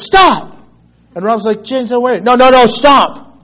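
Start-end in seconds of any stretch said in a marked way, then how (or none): 0.0-0.6 sudden high shouted stop; retold shout. James shouts to stop truck. 1.6-3.4 loud, high, drawn-out no no no; urgently begging James not to stop; retold loud protest. Driver Rob pleading loudly.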